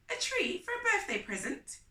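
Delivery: put-on high childish echo of the question; little brother Huey. A narrator's reading. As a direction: squeak it back like a copying toddler. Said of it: "Huey said".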